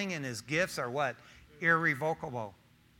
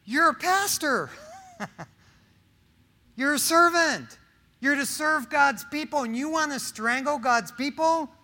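loud, high, scolding pastor; indignant mock lecture to grumbling pastors; mock rebuke; 8 semitones higher, 6 dB louder than usual. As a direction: scold them loudly, half joking. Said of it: "and I'm going".

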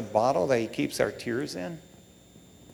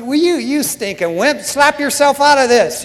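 loud, high scolding of himself; hammed-up pull-yourself-together rebuke; acted self-scolding; 9 semitones higher, 8 dB louder than usual. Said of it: "And I'm going".

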